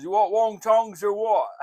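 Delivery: high, loud put-on voice; mock accent voice. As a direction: bark it like George on the phone. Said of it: "And he says".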